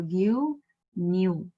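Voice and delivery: ordinary delivery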